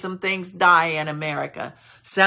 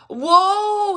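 awed cry; 12 semitones higher than usual. Acted. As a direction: cry out as if seeing God. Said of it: "And so he said".

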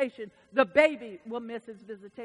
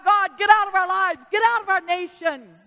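loud, high rejection of God; crying out; 5 semitones higher, 6 dB louder than usual. Retold or imitated. People crying God out of the nation, loud. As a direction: proclaim it like a defiant crowd. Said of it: "They're crying".

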